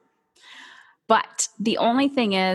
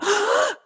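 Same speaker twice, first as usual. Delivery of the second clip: sharp high panicked gasp. Acted. Acting out a stressed parent's dread, a panicked gasp. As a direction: gasp in sudden alarm. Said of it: "when you live in a lack mindset of".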